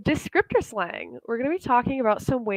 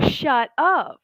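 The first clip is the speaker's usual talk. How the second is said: mildly excited, higher-pitched reaction; happy disbelief; 5 semitones higher, 3 dB louder than usual. Acted. Teacher acts out proposal news, happy disbelief. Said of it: "It's kind of like saying".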